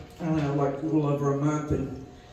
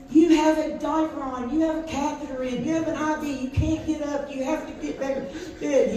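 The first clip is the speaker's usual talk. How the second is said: high pitched mock yell, laying down the rules; retold yelling; 8 semitones higher than usual. Sitter yelling rules at the old patient, high mock shout. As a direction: scold him loudly like a hard-of-hearing patient. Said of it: "screaming at him so loud".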